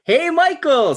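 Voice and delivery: loud high hail across the room; calling out. Acted. Spotting Michael across the bar, a loud high greeting. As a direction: call him over, high and loud.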